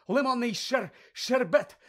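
high, loud, broken-off first try; gasped out. A narrator's reading. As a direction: blurt the password as if winded. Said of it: "видихнув він". (видихаючи, уривчасто)

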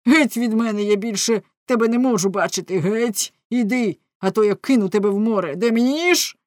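furious threat. A narrator's reading. Husband driving his wife away, threatening furiously. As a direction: order them away at a near shout.

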